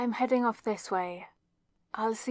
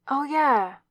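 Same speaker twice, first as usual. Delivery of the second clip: higher and louder burst of remembering; sudden realization; 5 semitones higher, 9 dB louder than usual. Acted. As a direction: blurt it as it hits you.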